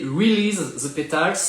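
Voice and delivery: French-accented English. Real, not acted. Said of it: "désolé pour mon accent anglais".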